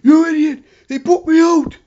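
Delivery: high, loud furious yell; Davey's furious shout. Retold or imitated. Davey screams at Owen after being eliminated, furious high yell. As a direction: scream at your partner in rage. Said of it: "Davey was irate".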